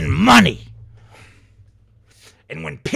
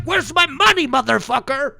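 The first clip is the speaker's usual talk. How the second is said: demanding; 7 semitones higher than usual. Retold or imitated. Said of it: "it's like".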